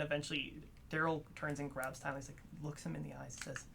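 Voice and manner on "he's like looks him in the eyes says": ordinary delivery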